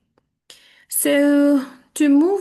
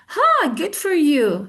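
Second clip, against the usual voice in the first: higher, drawn-out enthusiastic congratulation; 5 semitones higher, 3 dB louder, much slower than usual. Shown as a demonstration. Teacher models congratulations for students, enthusiastic. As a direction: stretch it with enthusiasm, pitched up. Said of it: "with an enthusiastic voice".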